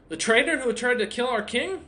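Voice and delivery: high, loud startled question; alarmed dwarf. Acted. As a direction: snap it out in shock. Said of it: "the dwarf looks at you and goes".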